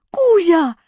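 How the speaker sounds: high, happy cat call; boy calling his cat, overjoyed. A narrator's reading. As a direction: call the kitty with joy. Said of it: "позвал я кота".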